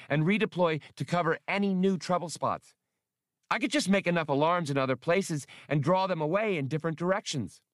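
high, quickening little computer; Max, eager. Acted. Small computer proposes fake alarms, eager and high. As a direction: rattle off the plan in a piping voice.

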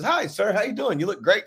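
high, sweet fan greeting. Retold or imitated. Fan stopping him on the street, high and sweet. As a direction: chirp it like an eager fan. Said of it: "That is".

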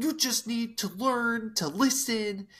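a hammed-up lecture from a grumpy granddad; mock angry granddad. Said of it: "just starts getting angry".

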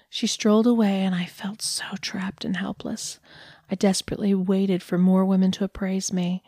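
ordinary delivery, nothing marked

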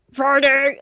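loud, strained attempt at whispering; mock stage whisper. Hinting to strangers from nearby, forced whisper. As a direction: push it out like a failed whisper. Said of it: "just trying to whisper to them".

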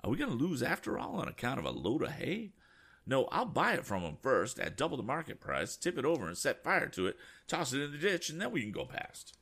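high, agitated indignant rant; indignant cry. Acted. Passenger blustering about buying the hay, high and agitated. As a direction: bluster like a rich eccentric. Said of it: "cried Mister Damon".

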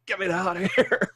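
high, rushed plea; mock panic. Chopped referee desperate to leave the ring, comic panic. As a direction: blurt it out, desperate to escape.

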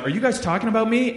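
lightly acted, pitch lifted question; questioning Brent. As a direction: ask it like a friend walking up on gossip. Acting out Brent walking up.

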